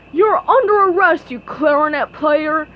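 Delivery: loud bellowed arrest; bellowing. A narrator's reading. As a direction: bellow it like a cop. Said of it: "Patrick bellowed".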